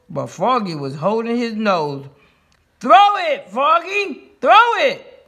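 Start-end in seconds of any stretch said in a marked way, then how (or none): 2.8-5.3 high, loud urging; high, pressing shouts; team screaming. A narrator's reading. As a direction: scream at a teammate to hurry. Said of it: "screamed the Giants".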